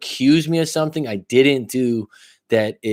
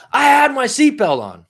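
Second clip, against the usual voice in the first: high, louder outraged protest; retold angry protest; 12 semitones higher, 4 dB louder than usual. Protesting to police officer about seatbelt, high outraged yell. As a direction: flip out at the cop. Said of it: "I fucking flipped".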